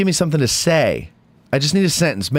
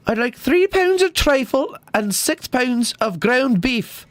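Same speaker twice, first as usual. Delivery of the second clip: high, slow Scottish woman's accent; 9 semitones higher, slower than usual. Shown as a demonstration. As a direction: put on a much higher voice with a Scottish accent. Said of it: "Do a Scottish woman".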